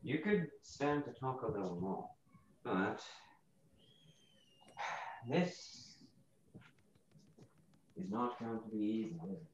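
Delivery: softly spoken, sinking almost out of hearing; quiet. Real, not acted. Player voices his character too far from the mic, quiet. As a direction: let the voice fade away from the mic. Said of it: "you're really quiet all of a sudden".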